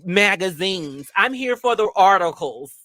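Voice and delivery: high, innocent excuse voice; mock excuse. Mocking bookstore regulars, high innocent excuse. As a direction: play the innocent customer. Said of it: "Everybody can say".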